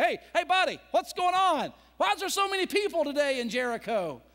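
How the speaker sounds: high, loud curious call; calling to a passer-by. Acted. Blind man asks a passer-by about the crowd, loud eager call. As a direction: grab a stranger's attention. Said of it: "he said to somebody".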